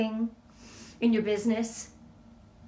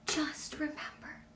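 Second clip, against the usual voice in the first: whisper; 5 semitones higher, 11 dB quieter, slower than usual. Real, not acted. Tender encouragement to the audience.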